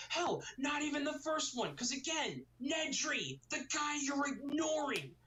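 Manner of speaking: high, exasperated insistence; exasperated rebuttal. Real, not acted. Reviewer reminding another of the guy he's ignoring, exasperated. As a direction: pitch it up and insist, fed up.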